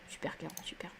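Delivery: marmonné très bas, presque sans voix; aparté chuchoté. Real, not acted. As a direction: commenter dans un souffle. Aparté après la réponse de Kero. (chuchoté pour soi)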